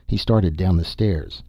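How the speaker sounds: ordinary delivery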